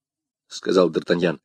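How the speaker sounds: usual speaking voice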